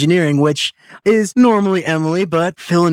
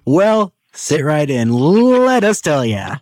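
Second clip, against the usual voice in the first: slow drawn-out tease; slower than usual. Acted. Teasing drawn-out set-up.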